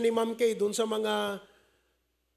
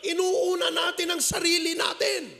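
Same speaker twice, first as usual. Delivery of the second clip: shouted rebuke; 7 semitones higher than usual. Acted. Pastor rebukes congregation.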